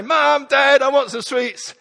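put-on high whining child asking for sweets; child's whine. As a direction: ask like a begging little kid. Child voiced in story.

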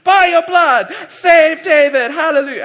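high, loud, slow fervent preacher cry; loud high hammed-up charismatic prayer. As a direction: belt it out like a revival prayer.

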